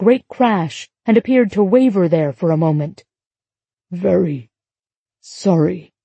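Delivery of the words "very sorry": faint, broken by a long pause; mildly fading, word by word; weak apology. A narrator's reading. Stranger about to pass out apologises, weakly. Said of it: "he sounded suddenly weak".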